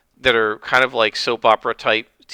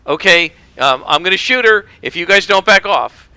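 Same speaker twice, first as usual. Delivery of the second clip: raised, higher and louder gunman's threat; villain threatening hostage; 7 semitones higher, 8 dB louder than usual. Retold or imitated. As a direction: bark it like a jumpy gunman holding a hostage. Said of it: "He held her at gunpoint and said".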